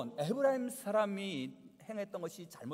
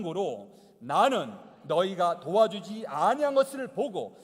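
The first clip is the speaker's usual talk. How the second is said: slow, louder reading of scripture; 8 dB louder than usual. Acted. Pastor leading the congregation through Judges, slow and loud. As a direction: read the passage aloud together, slow and strong.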